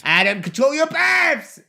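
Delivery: high, drawn-out scolding yell; exasperated shout. Real, not acted. Host scolding actor for burping, exasperated shout. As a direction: cry out in comic outrage. Mock scolding after loud burp.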